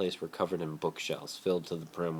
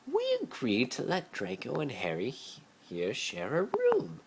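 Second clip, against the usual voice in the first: Narcissa's voice; 9 semitones higher than usual. A narrator's reading. Narcissa tells Lucius the boys share a room, sly and high. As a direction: lay out the plan with a knowing air.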